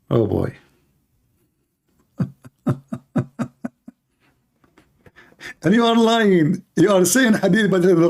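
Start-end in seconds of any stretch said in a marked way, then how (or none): 2.7-3.7 breathy scornful laugh under the breath; mocking laugh. Real, not acted. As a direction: laugh quietly at the claim. Mocking caller's claim.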